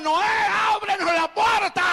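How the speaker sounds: loud, high-pitched plea; desperate shouting. Retold or imitated. People outside the ark begging Noah, desperate shouting. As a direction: shout it out like a crowd locked outside. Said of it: "Y ellos gritaban".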